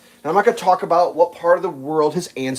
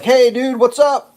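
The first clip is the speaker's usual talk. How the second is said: higher, louder call-out; retold friendly yell; mock yell; 7 semitones higher, 6 dB louder, slower than usual. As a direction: holler over to a guy, acted out. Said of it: "I was just gonna yell at him".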